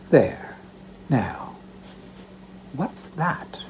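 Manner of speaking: hushed, as if the basket could hear; soft and secret. Acted. Daughter hushing over the basket, soft and secret. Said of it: "speaking very softly".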